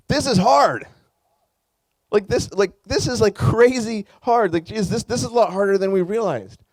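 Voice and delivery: preacher stressing to the congregation how hard this is, emphatic; emphatic realization. Real, not acted. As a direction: press the point with rising intensity.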